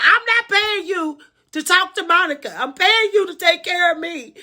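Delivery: retold yelling. Aunt yelling at her paid caregiver, high and indignant. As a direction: yell it from the background, pitched well up. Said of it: "She yelled in the background".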